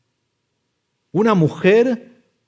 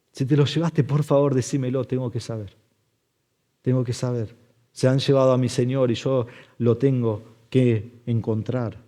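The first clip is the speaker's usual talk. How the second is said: much softer, lowered desperate plea; hushed pleading; 3 semitones lower, 7 dB quieter than usual. Acted. Mary begging the gardener to find her Lord, quietly desperate. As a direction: beg quietly, all force gone.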